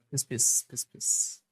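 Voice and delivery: soft unvoiced spray sound; spray hiss. Shown as a demonstration. Teacher demoing deodorant spray, soft hissing psst sound. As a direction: imitate a deodorant spray, breathy. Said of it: "वो लगा लेता हूँ".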